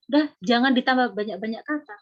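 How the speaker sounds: ordinary talk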